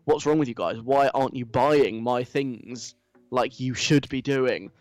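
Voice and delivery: usual speaking voice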